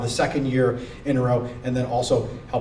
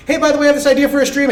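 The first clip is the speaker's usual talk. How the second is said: excited rush; 12 semitones higher, 7 dB louder, much faster than usual. Retold or imitated. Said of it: "be like".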